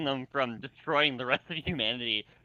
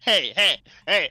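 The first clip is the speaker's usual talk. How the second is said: loud playful hey, hey, hey, much louder; mock protest; 4 semitones higher, 10 dB louder than usual. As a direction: call out the joke in mock offence, loud. Mock protest at a teasing joke.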